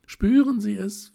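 rapturous call. A narrator's reading. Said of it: "atmete tief und rief".

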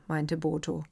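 ordinary delivery

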